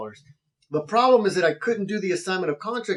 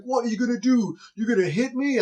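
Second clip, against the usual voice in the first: confrontational neighbor; 6 semitones higher than usual. Retold or imitated. Neighbor in his face.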